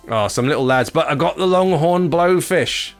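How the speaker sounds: usual speaking voice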